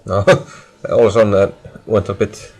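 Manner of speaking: ordinary talk, nothing marked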